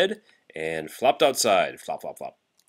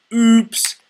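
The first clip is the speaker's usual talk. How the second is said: high loud squeak of oops; comic oops; 12 semitones higher, 9 dB louder than usual. Acted. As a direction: make the mishap funny. Froggy falls off chair.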